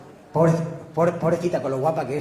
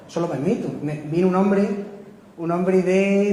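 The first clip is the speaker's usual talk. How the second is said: ordinary talk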